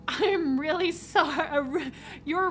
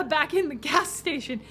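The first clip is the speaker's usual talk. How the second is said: usual speaking voice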